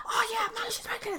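high, hushed mock schoolgirl gossip; gossiping girls. Girls gossip in the corridor about teacher, high and hushed. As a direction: mimic pupils passing on a rumour softly. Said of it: "hearing these chicks like".

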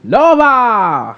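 high, drawn-out announcer's cry; announcer shout. Pretend. Co-host takes over Nova's introduction, epic announcer shout. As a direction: belt out the name like a ring announcer.

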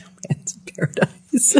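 repeating the title through breathy laughter; laughing. Real, not acted. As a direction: laugh the words out, amused.

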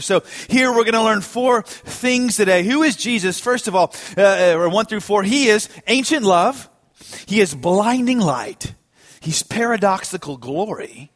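normal talking voice

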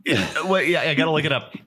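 normal talking voice

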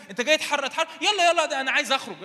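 high, loud, sarcastic eagerness; mock devil. Evil spirit mockingly begs deliverer to cast it out, high and loud. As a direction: play a spirit pretending it is keen to leave. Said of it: "إبليس يقول لي".

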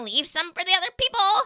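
high, loud cafeteria scold; scolding lunch lady. Retold or imitated. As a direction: imitate a lunch lady telling off a student.

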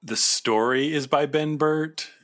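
ordinary talk, nothing marked

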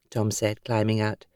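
usual speaking voice

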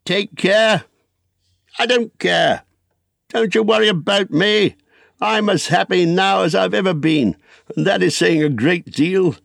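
high-pitched, carefree exuberance; elated. Acted. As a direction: brush off worry, thrilled to go. Elated Bilbo brushes off warning.

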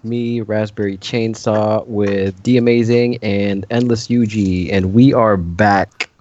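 podcast intro. Acted.

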